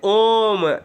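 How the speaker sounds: ओम held long and slow as a pluta vowel; drawn-out om. Shown as a demonstration. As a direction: stretch the ओम out slowly. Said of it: "ओम को ऐसे भी तीन लिख दिया जाता है". (ओम को लंबा खींचकर धीरे बोलना)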